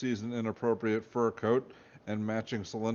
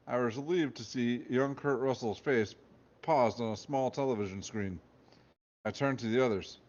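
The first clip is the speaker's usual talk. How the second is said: usual speaking voice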